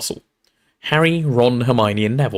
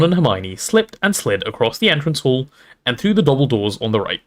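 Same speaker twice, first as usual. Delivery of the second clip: ordinary delivery, nothing marked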